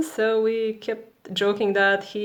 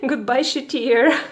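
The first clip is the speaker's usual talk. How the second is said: laughing through the words; 6 semitones higher, 4 dB louder than usual. Real, not acted. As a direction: giggle it out. Laughing farewell to the year.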